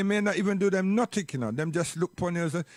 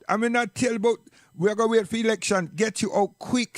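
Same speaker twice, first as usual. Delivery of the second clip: ordinary talk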